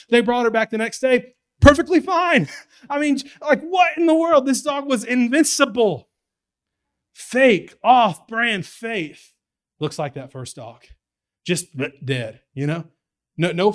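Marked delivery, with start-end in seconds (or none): 0.1-6.1 much higher and louder, laughing disbelief; can you believe this, shout it; astonished outburst. Real, not acted. Preacher amazed at the invincible dog, high and loud. 7.1-9.3 loud and very slow, each word weighed; slow emphatic scorn. Real, not acted. Preacher condemning fake faith to the church, slow and loud. Sermon punchline.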